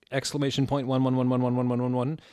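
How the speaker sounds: fast, deadpan run of ones; rushed recitation. Shown as a demonstration. Reading the author's note's typed exclamation points. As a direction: rattle the ones off quickly. Reading silly punctuation aloud.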